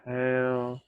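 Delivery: stretched long across the breath. Real, not acted. Leading a slow breathing exercise.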